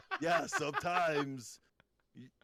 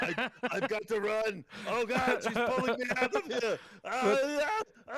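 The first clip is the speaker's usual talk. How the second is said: higher, louder panicked cry as he's pulled away; fading away; 9 semitones higher, 5 dB louder than usual. Acted. Arnold pulled away as the wife revives her husband. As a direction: Arnold yelping as he vanishes. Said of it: "She's reviving her husband".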